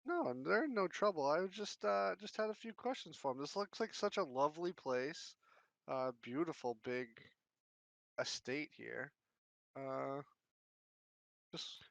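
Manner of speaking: chirpy would-be private eye, talking up a stranger; detective Harold. Acted. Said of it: "he says".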